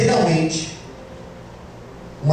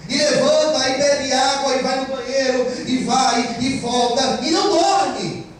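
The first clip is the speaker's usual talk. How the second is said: raised, fervent preacher voice; preaching; 10 semitones higher than usual. Acted. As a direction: preach it out to the church. Preaching about Nehemiah. (pregação fervorosa)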